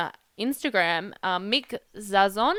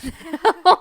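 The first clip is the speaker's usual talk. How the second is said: laughing; 7 semitones higher, 9 dB louder than usual. Real, not acted. Laughs at her own remark.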